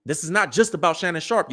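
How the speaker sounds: ordinary talk, nothing marked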